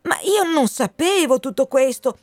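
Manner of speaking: high, urgent protest; earnest Wind figure. Acted. Wind swears to the colonel he knew nothing, earnest protest.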